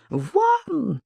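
very high shrieked command; hammered-up shriek; old woman's scream. A narrator's reading. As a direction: screech it at the intruder. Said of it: "снова завопила старуха".

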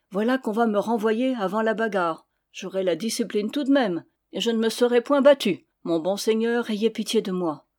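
plain reading voice

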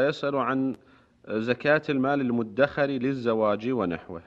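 ordinary delivery

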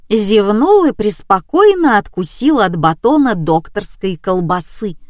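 ordinary delivery

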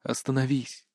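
soft, low, hushed plea; murmured in her ear. A narrator's reading. Kris asks struggling Berta to stop, softly in her ear. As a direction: say it quietly, just for one listener. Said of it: "шепнул на ушко".

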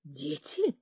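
low, quiet, stunned rising question; taken aback. A narrator's reading. Officer stunned to hear about children, quiet and low. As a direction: caught completely off guard. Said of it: "на секунду опешил тот".

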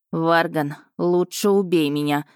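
plain reading voice

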